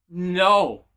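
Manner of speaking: usual speaking voice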